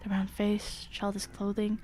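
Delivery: low, hushed horror storytelling; story narration. A narrator's reading. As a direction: read it low and quiet, drawing us in. Reading horror story aloud.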